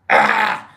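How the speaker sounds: short rasped scream; imitated metal scream. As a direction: rasp out a quick metal scream. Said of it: "and scream".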